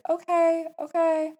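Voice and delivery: high, loud, uneasy okays; retold nervous agreement, pitched right up; anxious okay. Reluctantly accepts leaving cat overnight.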